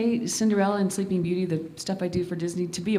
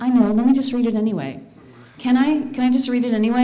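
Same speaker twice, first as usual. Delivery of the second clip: quick, louder, higher-pitched begging; retold insistent pleading; 6 semitones higher, 6 dB louder, faster than usual. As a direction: push the agent to give in. Retold pleading with her agents.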